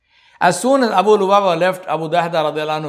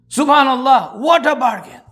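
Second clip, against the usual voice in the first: higher but less forceful repeat of the wonder; echoed exclamation; 6 semitones higher than usual. Retold or imitated. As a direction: let the amazement settle as you say it again.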